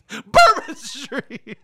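imitating a co-host's yell, high and drawn out; mock yell. Teasing the co-host for yelling Bourbon Street, mock yell. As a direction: holler it slowly in loud, exaggerated mimicry. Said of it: "Why did you yell like".

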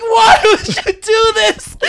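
high pitched, teary cry; tearful. Real, not acted. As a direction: plead through tears. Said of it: "I'm tearing up right now".

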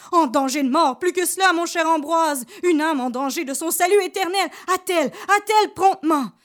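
high, loud, pressing priest; urgent shout. Acted. Priest pressing Ambroise to harness the mare, urgent high shout. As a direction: drive the servant on with fearful urgency. Priest urging servant to hurry.

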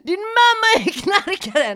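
high, loud, drawn-out mock scream; wailing comic shriek, re-enacted; retold screaming. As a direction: scream the insult slowly, hammed up. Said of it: "jag bara skrek allting så här".

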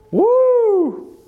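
very high, long "woo"; excited whoop. Real, not acted. Preacher thrilled by the centurion's faith, high excited whoop.

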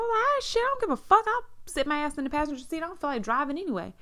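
very high-pitched, fast sassy retort; high squealed mock indignation; retold defiant comeback. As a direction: act out not caring, voice shot way up. Retold quarrel, her defiant comeback.

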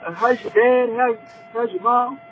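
polite voice. Retold or imitated. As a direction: sweet-talk like a nervous clerk. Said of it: "he like".

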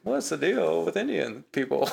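mock stand-up setup, drawn out; Seinfeld impression. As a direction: ham up the observational comic. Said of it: "I feel like we did Seinfeld".

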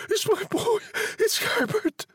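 frantic high-pitched mother's cry. A narrator's reading. Mother struggling in husband's grip, frantic high cry. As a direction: plead in a very high woman's voice. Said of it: "she cried".

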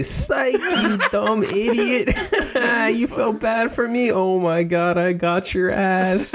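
high, laughing mock gloat; gloating taunt. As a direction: rub it in, cackling at the victim. Prank reveal taunting the caller.

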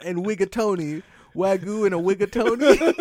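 Wigatoni said with w for Rigatoni, high; says w for r. Pretend. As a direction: Wigatoni with a w sound, loud. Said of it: "can't pronounce his R's".

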